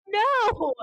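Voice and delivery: high, loud pretend cry of shock; horrified no. Imagining her own son flipping someone off, high horrified no. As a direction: yelp it like a parent caught off guard. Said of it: "I would say".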